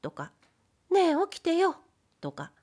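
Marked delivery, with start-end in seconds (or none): 0.9-1.8 high, wheedling wake-up call; wife coaxing her sleeping husband; teasing wife. A narrator's reading. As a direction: nudge him awake with a pout. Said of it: "まだふざけ足りないと見え".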